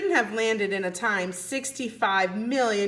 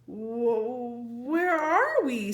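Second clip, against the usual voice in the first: stammered; 2 semitones higher, much slower than usual. Acted. Jack stammers at the strange new world, frightened. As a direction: stumble on the first word, then ask in alarm. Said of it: "stammered Jack".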